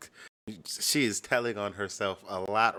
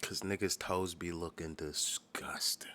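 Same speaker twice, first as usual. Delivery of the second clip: whisper; 4 semitones lower, 7 dB quieter, much slower than usual. Acted. Mocking men's feet.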